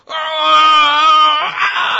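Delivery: high, loud strangled yell of frustration; frustrated shout. Retold or imitated. Pastor reliving the moment he yelled at the neighbor's cat. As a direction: let out a furious strangled cry. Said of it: "all I could do was go".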